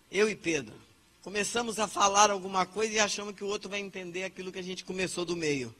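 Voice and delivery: ordinary delivery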